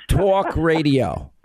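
"talk radio" in slow, exaggerated New York accent. Shown as a demonstration. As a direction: stretch "talk" with a thick New York vowel. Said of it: "say it in a New York accent".

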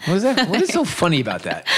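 amused what, going up high; laughing. Real, not acted. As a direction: chuckle through a mock protest. Reacting to friend's laughter.

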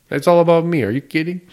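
loud, high, brash self-obsessed voice; mock narcissist. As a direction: belt it out like a self-absorbed boss. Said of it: "He's like".

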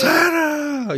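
shrieked, very high "Santa!"; excited shout. Retold or imitated. Buddy hears Santa is coming, very high excited shriek. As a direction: scream it like an overjoyed child.

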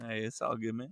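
soft, hushed reassurance; quiet. Real, not acted. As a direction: say it softly and low. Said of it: "And you voiced this".

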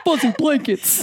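singing through laughter; giggling out the words slowly; laughing. Real, not acted. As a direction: laugh as you sing it.

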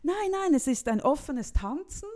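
a hint of an excited old dancer correcting her; eager old man. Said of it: "Er so".

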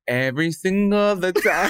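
teasing echo of the flub; mock repeat. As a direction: parrot the flubbed phrase with a laugh. Mocking partner's flub.

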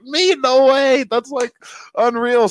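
high-pitched, incredulous reaction; acted disbelief. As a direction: jump way up in pitch, amazed. Acting out his disbelief at news.

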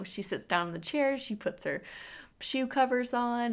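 ordinary delivery

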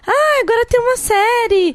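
high, excited mock fan voice; mimicking eager fans. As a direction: squeal it like a hyped fan. Mimics people hyping the series.

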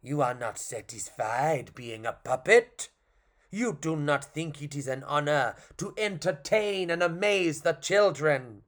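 put-on fairy-clown with a vague French accent. Clown posing as fairy to the puppet, French accent. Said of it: "which is French".

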